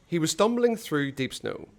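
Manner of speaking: usual speaking voice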